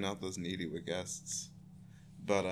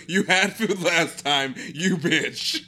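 higher, louder, slow indignant dog voice; voicing the dog; 7 semitones higher, 8 dB louder, slower than usual. Owner voices her dog scolding the guest, high and loud. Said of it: "She's like".

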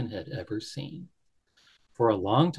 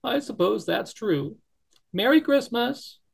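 mild tearful bunny; 11 semitones higher than usual. Acted. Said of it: "his eyes filling with tears".

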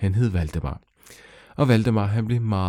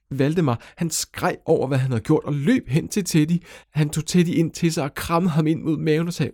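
plain reading voice

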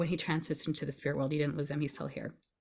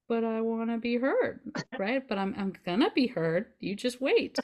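higher, half-threatening little voice; a pushed-away feeling vowing to be heard, higher; defiant inner part; 6 semitones higher than usual. Retold or imitated. The shut-away feeling answering back, higher and defiant. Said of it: "And she's like".

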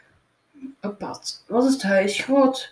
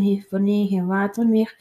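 plain reading voice

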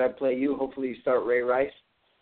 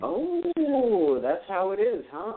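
mock surprise; 7 semitones higher, much slower than usual. Real, not acted. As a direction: jump up in playful surprise. Co-host taunts about beating him.

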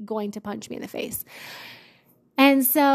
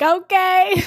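high, louder startled okay; 8 semitones higher, 5 dB louder than usual. Retold or imitated. Bethany answering the nurse, startled high okay. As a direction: squeak it out, caught off guard. Nervous reply to angry nurse.

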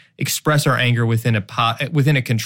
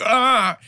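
short burst of a mock Hulk roar, much higher; 10 semitones higher than usual. Husband admits turning into the Hulk, mock roar. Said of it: "I turn into the Hulk".

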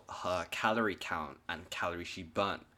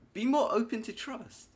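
much higher, louder cartoon squeak; squeaky silly voice; 8 dB louder than usual. Echoes advice in a silly voice.

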